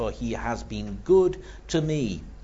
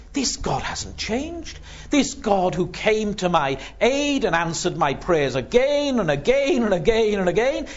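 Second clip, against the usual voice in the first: much higher, fervent preaching peak; preaching climax; 9 semitones higher than usual. Acted. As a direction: lift the congregation, soaring conviction. Preacher's climax on God's faithfulness.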